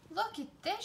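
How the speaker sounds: high, excited discovery; excited cry. Acted. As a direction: show off the find with delight. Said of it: "she cried".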